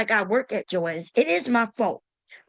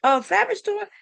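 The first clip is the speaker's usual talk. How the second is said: high, incredulous squeak at the request; mock outrage, pitch jumping up; imitating the lady's disbelief; shocked disbelief; 7 semitones higher than usual. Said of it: "she was like".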